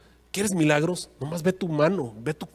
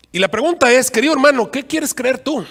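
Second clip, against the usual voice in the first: más alto y fuerte, exhortando a los hermanos; lánzale la pregunta a la congregación con fuerza; interpelación fuerte; 8 semitones higher, 8 dB louder than usual. Real, not acted. (el pastor pregunta a los hermanos, en voz alta y aguda)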